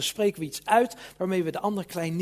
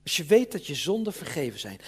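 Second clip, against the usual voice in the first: ordinary delivery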